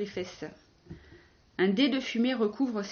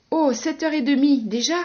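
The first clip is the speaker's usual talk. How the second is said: loud, higher startled exclamation; acted surprise; 6 semitones higher, 12 dB louder than usual. Checking the time with Philippe waiting, loudly surprised. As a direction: react loudly to how late it is. She finds out it is late.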